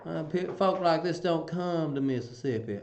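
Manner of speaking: lightly put-on Mississippi accent; a hint of Mississippi twang; Southern drawl. Shown as a demonstration. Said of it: "I quite like the Southern drawl".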